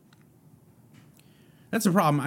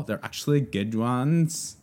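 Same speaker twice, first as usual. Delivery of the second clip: put-on slow, drawn-out Valley Girl uptalk; 2 semitones higher than usual. Shown as a demonstration. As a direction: stretch it out like a Valley Girl, rising at the end. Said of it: "said it like a Valley Girl".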